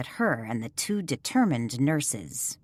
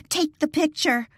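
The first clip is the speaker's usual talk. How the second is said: high, loud frantic repeat; panicked cry to her friend; hysterical call; 12 semitones higher, 3 dB louder than usual. Acted. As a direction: shriek it as the nurses charge. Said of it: "Lily called out hysterically".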